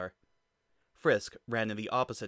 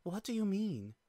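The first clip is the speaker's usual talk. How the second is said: worried question; 10 semitones higher than usual. Acted. Toriel alarmed question.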